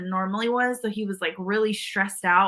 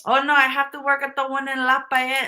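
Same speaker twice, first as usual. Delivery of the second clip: imitated Filipino-accented English, slightly raised; Filipina coworker's accent; 3 semitones higher than usual. Imitating the coworker at Jack in the Box, Filipino accent. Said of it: "that's how she said it".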